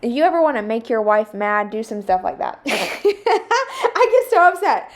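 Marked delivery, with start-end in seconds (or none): 2.7-4.9 high, slow, laughing complaint; laughing upset. Real, not acted. Wife laughing about her husband's habit, high slow laughing complaint. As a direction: laugh while admitting you're upset.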